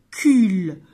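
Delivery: "cule" loud and drawn out; syllable sounding-out. Shown as a demonstration. Teacher repeating the last syllable, "cule" loud and long. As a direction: isolate "cule" and stretch it loudly. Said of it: "de cette syllabe".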